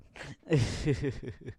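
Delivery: short chuckle at yourself; embarrassed laugh. Real, not acted. Streamer groaning oh God at their mistake, chuckling. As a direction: laugh off a silly slip.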